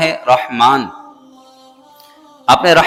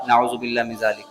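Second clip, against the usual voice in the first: much softer, lower disclaimer; hushed pious aside; 4 semitones lower, 8 dB quieter than usual. Real, not acted. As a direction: drop the voice to disown it. Pious aside after quoting idol worshippers. (دبی آواز میں استغفاری جملہ)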